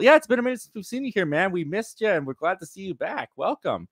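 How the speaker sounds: ordinary talk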